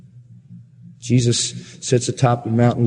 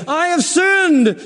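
Saul; much slower than usual. Acted. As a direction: cry out a showy, drawn-out confession. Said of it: "Saul in First Samuel fifteen, who says".

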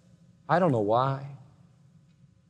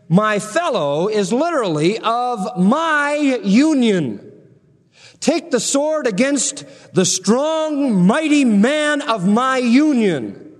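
much higher, slow emphatic reading; scripture proclaimed; 7 semitones higher, slower than usual. Acted. Preacher proclaiming the mighty man of God's union, slow and lifted. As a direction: proclaim each phrase slowly and grandly.

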